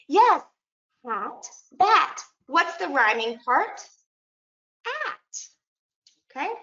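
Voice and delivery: lightly put-on teacher of young children; teacher hat. Shown as a demonstration. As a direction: model the words one by one for a class. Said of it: "back to my teacher hat".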